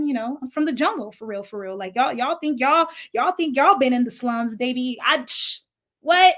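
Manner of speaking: ordinary talk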